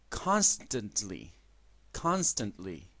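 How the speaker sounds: Constantly said slowly, /konstantli/; slow model word. Shown as a demonstration. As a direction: drill Constantly slowly for repeating. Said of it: "Repitan después de mí".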